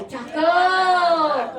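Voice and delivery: long, high, loud call; loud response cue. Real, not acted. Preacher prompts congregation after a pantun line, calling out. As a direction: lead the crowd in calling it out.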